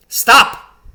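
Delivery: high, loud witch's stop; sharp command. A narrator's reading. Witch halting her followers, high and loud. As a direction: cut them off sharply. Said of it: "said the witch".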